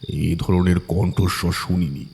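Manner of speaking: ordinary delivery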